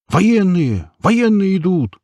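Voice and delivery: high, drawn-out warning cries; urgent high-pitched shouting; alarmed clamour. A narrator's reading. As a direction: raise the alarm to a crowd. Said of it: "зашумели сталкеры".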